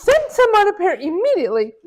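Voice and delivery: high, loud urgent order; urgent demand. Retold or imitated. Stuck mom ordering the secretary, high loud urgent demand. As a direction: insist it must happen now. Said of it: "She goes".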